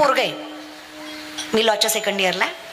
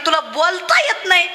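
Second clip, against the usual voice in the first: ordinary talk, nothing marked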